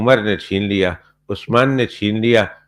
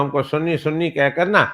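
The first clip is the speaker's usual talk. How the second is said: ordinary talk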